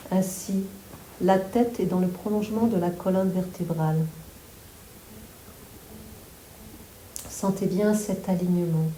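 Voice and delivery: calm, unhurried yoga nidra guidance; guided meditation. Real, not acted. Yoga teacher guiding listeners to relax the whole body, guided meditation. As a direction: guide them through the body, phrase by phrase with long pauses.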